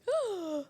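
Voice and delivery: sharp, high pretend gasp; mock shocked gasp. Asking how mom took it, mock shocked gasp. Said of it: "Was mom like".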